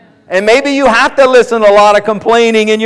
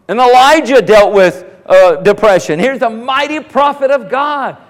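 ordinary talk

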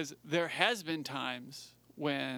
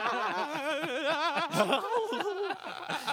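high goofy clown cackle; silly clown laugh; 12 semitones higher than usual. Real, not acted. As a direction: laugh like a birthday party clown. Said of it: "You sound like a clown".

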